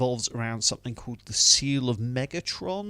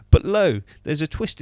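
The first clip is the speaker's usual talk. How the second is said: higher, louder, mock-biblical "But lo"; mock grand "lo"; 6 semitones higher, 9 dB louder than usual. Reviewer teasing the film's twist, in a hammed-up herald voice. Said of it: "mock grand announcement".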